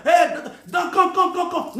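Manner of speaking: urgent high call. Real, not acted. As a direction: shout to someone to come. Calling out, shout.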